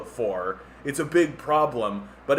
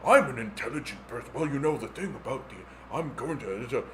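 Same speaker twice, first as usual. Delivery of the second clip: softer, halting self-important talk; Elon Musk impression; 3 semitones lower, 5 dB quieter than usual. Lightly voiced Elon Musk in interviews, softer and halting. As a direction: sound like a special boy. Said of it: "he talks like, like this".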